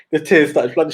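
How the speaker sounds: usual speaking voice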